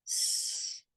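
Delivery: voiceless "S", just air; s sound. Shown as a demonstration. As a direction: hiss a long "S" as in sun, no voice. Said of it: "but we say s".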